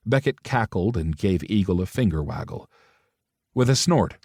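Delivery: ordinary delivery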